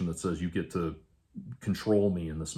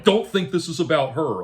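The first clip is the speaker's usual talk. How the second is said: higher, louder mocking echo of Don't think this is about her; mock his jealous jab, pitched up; mocking imitation; 8 semitones higher, 6 dB louder than usual. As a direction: throw his line back with scorn. Mocking his line again.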